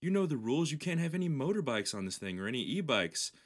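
a hint of a fussy warden; lightly voiced scold. Trail guy scolds the rider.

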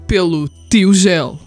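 ordinary delivery

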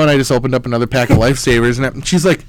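normal talking voice

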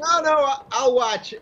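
protesting. Real, not acted. Squeamish about cricket.

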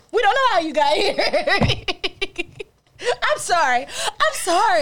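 high voice breaking into laughter; can barely talk from laughing; laughing through words. Real, not acted. Laughing hard.

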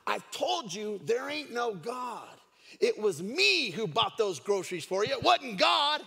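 loud, high, triumphant crowing; hammed-up scoffing neighbor, shouting; gloating neighbor. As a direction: jump out and crow "gotcha". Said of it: "her neighbor jumped out of the bushes".

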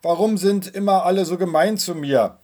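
put-on boy complaining reproachfully to his mother; reproachful complaint. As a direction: accuse everyone of being unfair. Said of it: "beschwerte er sich vorwurfsvoll".

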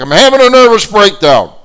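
high, loud outburst of distress; upset, mad yelling. Retold or imitated. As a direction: holler it as if beside yourself. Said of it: "she's upset. She's mad".

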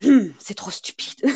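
breathy whisper in French; whispered French aside. Retold or imitated. French inspector muttering to himself, whispered. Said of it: "He says something like".

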